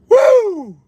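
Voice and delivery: high, loud woo; excited whoop. Real, not acted. Streamer cheering after a big laugh, loud whoop.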